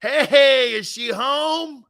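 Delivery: high, slow, eerie creep voice; Poltergeist hat guy impression. Host imitating the Poltergeist hat man to mock the owner. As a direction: drawl it like the ghostly man in the hat. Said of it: "The guy in the hat on Poltergeist".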